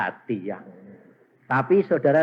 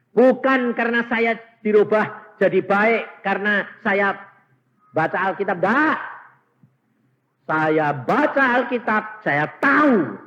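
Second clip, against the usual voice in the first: emphatic preaching; 8 semitones higher, 8 dB louder than usual. Acted. Preacher drives home a point. (khotbah berapi-api, lantang dan tinggi)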